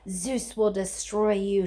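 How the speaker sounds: slow, drawn-out monster threat; lightly voiced hissing fury; slow, grim threat from the monster; menacing promise. A narrator's reading. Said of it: "she promised".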